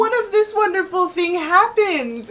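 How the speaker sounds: high, loud gushing wonder; sing-out bubbly mock enthusiasm; super positive. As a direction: imitate an endlessly optimistic mom. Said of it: "she's super positive".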